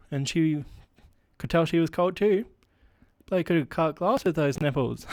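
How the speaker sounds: hammed-up bro comedian, pitched a bit up; straight male stand-up. Comic opening a pretend set for the crowd, hammed-up bro comedian. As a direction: play a matey club comic working the room. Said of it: "Apparently I'm a straight he, him, male".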